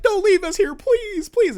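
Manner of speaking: put-on high, loud pleading kid voice; childlike wail of a plea; innocent bubbly child. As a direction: beg like a hammed-up little child. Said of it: "innocent little bubbly child".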